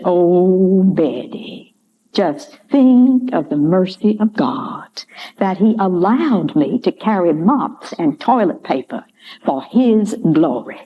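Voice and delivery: slow, lightly voiced Georgia Southern lilt; a hint of an old Georgia lady, slowed down; sweet Southern accent. House mother to Betty about God's mercy, light slow Georgia accent. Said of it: "in her sweet Southern way".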